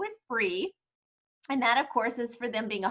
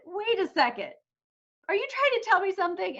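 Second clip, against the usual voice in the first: higher, quicker puzzled question; dawning suspicion; 7 semitones higher, faster than usual. Retold or imitated. Client realizing Tony is making a point, puzzled and quick. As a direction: catch on mid-sentence, half suspicious. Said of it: "he said".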